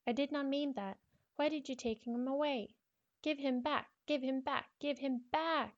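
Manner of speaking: put-on a hurt girl shouting give him back; upset girl voice. Diary girl pleads angrily.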